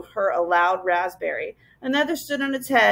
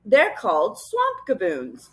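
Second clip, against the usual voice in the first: put-on a measured old professor; slower than usual. A narrator's reading. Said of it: "said the professor".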